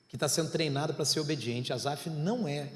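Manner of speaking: ordinary talk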